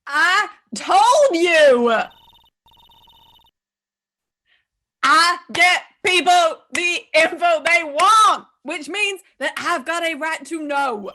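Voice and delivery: loud, brash reporter; pushy reporter voice. Acted. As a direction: shout it at the cop. Reporter character argues with cop.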